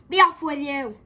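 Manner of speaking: angry storybook bellow; angrier troll. A narrator's reading. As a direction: shout them away as a furious troll. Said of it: "even angrier".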